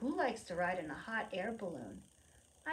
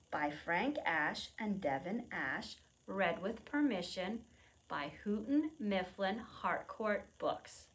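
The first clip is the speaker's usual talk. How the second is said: ordinary talk, nothing marked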